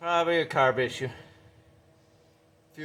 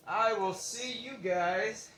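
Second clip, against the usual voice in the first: drawn out, higher, lilting farewell; slow sing-song sign-off; 6 semitones higher, 3 dB quieter, slower than usual. Real, not acted. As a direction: stretch each word like a singalong goodbye. Sign-off repeated differently.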